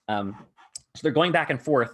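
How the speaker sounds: ordinary talk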